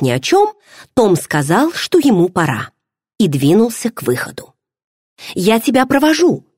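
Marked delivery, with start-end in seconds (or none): 5.3-6.5 quick bright exclamation, pitched higher; eager exclamation to walk him out; exclaiming. A narrator's reading. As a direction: call it out eagerly as she jumps up. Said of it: "воскликнула я".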